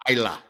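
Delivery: Isla said as EYE-la, silent s; correct pronunciation. Shown as a demonstration. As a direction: say Isla with a long i and short la. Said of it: "it's pronounced Isla".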